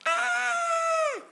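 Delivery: very high, loud sustained shriek; acted scream held on one high note. Storyteller imitating screaming in Mitzrayim at midnight, shrieking.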